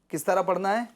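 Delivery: usual speaking voice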